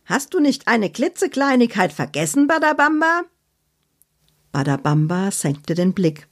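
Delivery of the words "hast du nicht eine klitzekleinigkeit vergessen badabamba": high, pointed teasing question rising at the end; Annabella's voice. Acted. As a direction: needle him with a high sly reminder. Annabella's character voice.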